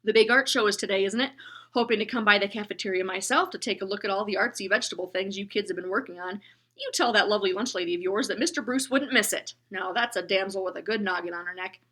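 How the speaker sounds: loud, hearty encouragement; booming bus driver. Acted. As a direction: boom warmly at the boy. Bus driver chatting loudly.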